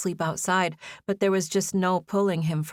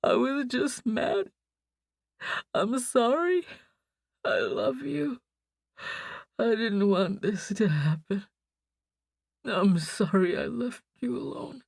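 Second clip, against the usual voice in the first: higher, slow mumbled apology; slow tearful mumble; 4 semitones higher than usual. A narrator's reading. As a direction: heartbroken sleep-talk, slow. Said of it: "He spoke slowly".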